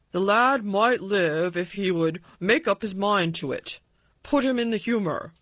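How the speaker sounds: a hint of a cross doctor; doctor in a temper. A narrator's reading. Colin quoting the London doctor, lightly voiced temper. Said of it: "It sounded as if he was in a temper".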